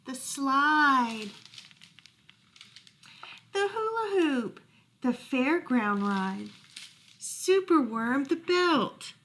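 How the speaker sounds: bouncy rhyming storybook read; reading to children. A narrator's reading. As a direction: read it with playful rhythm for kids. Reading a rhyming picture book.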